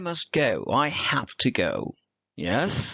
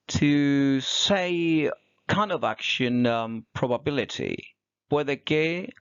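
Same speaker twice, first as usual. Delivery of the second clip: normal talking voice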